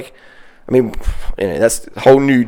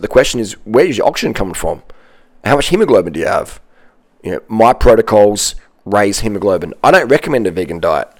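ordinary talk

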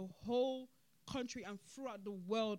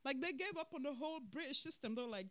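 usual speaking voice

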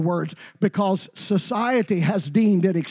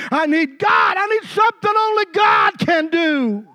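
desperate plea that builds to a cry, higher; pleading Hannah; 6 semitones higher, 4 dB louder than usual. Acted. Hannah pleading with Eli, building to a desperate cry. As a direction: beg from the heart, rising to a shout.

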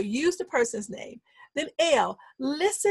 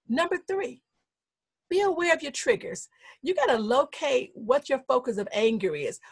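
ordinary talk, nothing marked

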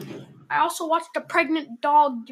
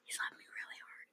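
whisper; 24 dB quieter than usual. Real, not acted. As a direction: whisper it to the listeners. Whispered aside again.

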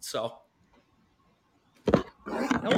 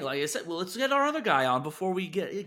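usual speaking voice

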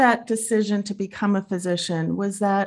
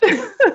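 real laugh; 11 semitones higher, 6 dB louder than usual. Real, not acted. Interviewer laughs at starving artist.

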